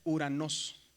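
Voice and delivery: teacher's slow model of Greek "uranos"; Greek pronunciation demo. Shown as a demonstration. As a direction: say "uranos" slowly with no O before the U. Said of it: "se pronuncia solamente la U".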